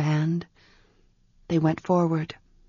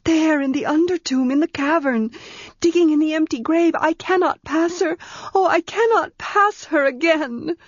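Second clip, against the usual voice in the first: higher and higher, rising panic; frightened girl; 9 semitones higher than usual. A narrator's reading. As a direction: build to a panicked cry she cannot pass. Girl terrified of the priestess.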